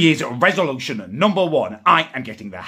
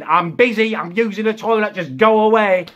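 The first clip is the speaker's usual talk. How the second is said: higher-pitched mock yelling from behind a door; irritated mum; 8 semitones higher, 4 dB louder than usual. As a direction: imitate mum shooing someone off. Acting out mum's irritated replies.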